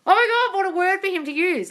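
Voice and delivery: retold giddy shock; excited disbelief. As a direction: gush like you can't believe it. Shocked by friend's word.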